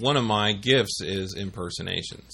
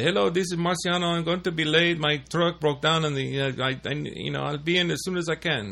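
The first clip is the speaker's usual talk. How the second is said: higher, fast put-on voice of a Filipino coworker; imitating Marciano; 6 semitones higher, faster than usual. Prank caller faking coworker calling in late, high and quick. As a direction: rattle it off high and quick, playing the late worker. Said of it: "I could imitate him dead on".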